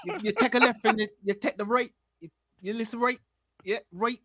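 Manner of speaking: put-on joking Bajan accent giving directions; mock Bajan accent. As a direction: give directions in a playful Barbadian lilt. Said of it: "If I could do the accent".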